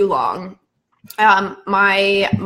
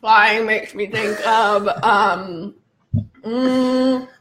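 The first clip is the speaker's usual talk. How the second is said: slow musing; much slower than usual. Real, not acted. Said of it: "slow word-chain monologue".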